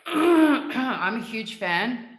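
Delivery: slow, loud and emphatic; excited. Real, not acted. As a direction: gush about it. Excited about peppermint.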